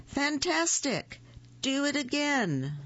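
high-pitched, mock shouting in narration; excited shout. A narrator's reading. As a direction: exclaim it high and eager, without real volume. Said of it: "Elizabeth shouted".

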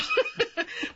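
a quick high burst of laughter; bright laugh. Real, not acted. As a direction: laugh out loud. Host laughs at radio voice.

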